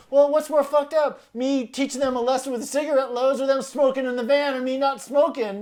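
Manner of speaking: defending the prank to Ian, loud and cheeky; defiant argument. Retold or imitated. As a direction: argue back with swagger. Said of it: "I go".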